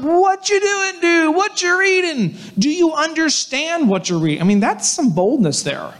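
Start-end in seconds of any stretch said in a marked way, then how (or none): 0.0-1.4 high-pitched mock yell; pitched-up, hammed shout to a stranger. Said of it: "So he's yelling". 1.4-4.4 high, sustained mock holler; pitched-up mock shouting; yelling. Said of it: "So he's yelling".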